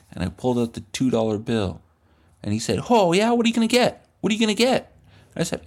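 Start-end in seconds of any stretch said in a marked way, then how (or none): 2.9-4.9 put-on high, louder pushy kid voice; high needling voice; pushy cool kid. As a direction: press him eagerly, pitched up. Pushy kid pressing him.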